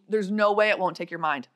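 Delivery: usual speaking voice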